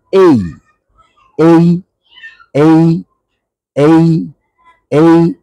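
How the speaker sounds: "এই" as a gliding ay, drawn out; letter A pronunciation. Shown as a demonstration. Teacher drilling learners on the letter A, "এই" said as ay. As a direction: say "এই" as a long diphthong, repeated singly. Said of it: "এর উচ্চারণ আমরা বলবো".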